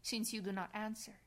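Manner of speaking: ordinary talk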